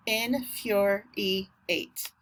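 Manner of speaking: INFURIATE very slowly in four beats; slow syllable-by-syllable infuriate; split into syllables. Shown as a demonstration. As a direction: break infuriate into in, fur, e, eight. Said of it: "Four syllables".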